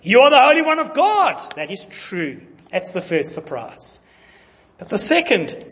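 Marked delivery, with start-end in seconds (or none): 0.0-1.5 high, loud demon cry; demon declaring. Retold or imitated. Preacher quoting the demon's cry to Jesus. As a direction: announce it like a demon. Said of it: "demons have accurate information".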